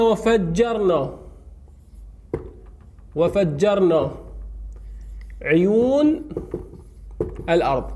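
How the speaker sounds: وفجرنا drawn out, then عيون and الأرض after long pauses; very slow word by word. Shown as a demonstration. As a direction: dictate each word slowly with long gaps. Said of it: "راح أقول له". (نطق بطيء جدا كلمة كلمة)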